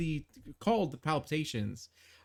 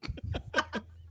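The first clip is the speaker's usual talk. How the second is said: silent, airy burst of laughter; breathless laugh; 14 semitones higher, 4 dB quieter than usual. Real, not acted. GM laughs at a player's joke question, breathy. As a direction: crack up without voice, just breath.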